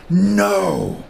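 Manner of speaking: a forceful no that stops him cold; powerful no. Retold or imitated. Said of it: "Like, so powerfully".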